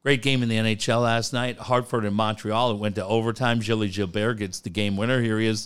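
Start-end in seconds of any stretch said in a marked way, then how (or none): none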